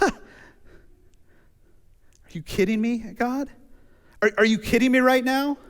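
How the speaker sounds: high, loud scoff at God; scoffing disbelief. Retold or imitated. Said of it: "you scoff at him a little bit".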